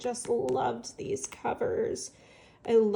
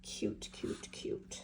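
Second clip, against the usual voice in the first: breathy whispered cooing; whispering; 10 dB quieter, slower than usual. Real, not acted. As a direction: whisper it, charmed by it. Cooing over a cute book.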